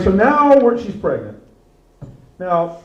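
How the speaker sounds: ordinary talk, nothing marked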